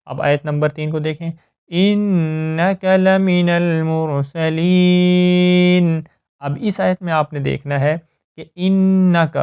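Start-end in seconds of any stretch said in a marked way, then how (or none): 1.7-6.0 slow melodic tajweed chant; Quran recitation. Shown as a demonstration. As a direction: recite it slowly with ghunna. (قرآن کی تجوید کے ساتھ آہستہ تلاوت)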